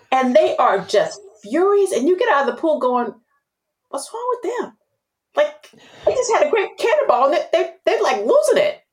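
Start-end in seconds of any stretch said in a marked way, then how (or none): 3.9-8.8 high, bewildered oblivious voice; clueless puzzlement. Retold or imitated. Swimmer wondering why the wet guests are angry, high and baffled. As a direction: play dumb about the angry guests, pitched way up.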